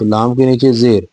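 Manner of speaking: normal talking voice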